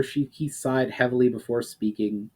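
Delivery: usual speaking voice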